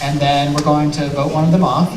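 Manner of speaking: normal talking voice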